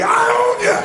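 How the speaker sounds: very high, forceful shout; intense challenge. Shown as a demonstration. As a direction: yell it with full intensity. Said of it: "say it with more intensity".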